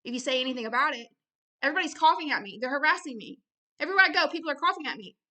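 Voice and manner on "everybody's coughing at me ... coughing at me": louder, higher protest of a victim; distressed complainer. Acted. Harassed person telling others about it, loud agitated complaint. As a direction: complain urgently that you're being persecuted.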